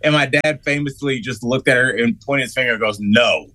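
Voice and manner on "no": imitating a stern dad's firm no; stern refusal. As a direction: refuse firmly, like an unamused father. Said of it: "he was so stern".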